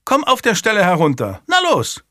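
high, loud order; impatient call, pitched right up; scolding governess. A narrator's reading. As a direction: order the child down at once. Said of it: "rief die Gouvernante".

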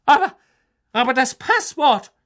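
high, loud stammering disbelief; aghast. A narrator's reading. McGonagall stammering at the portrait, high and loud. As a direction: splutter in shock.

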